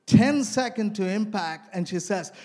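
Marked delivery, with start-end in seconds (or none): none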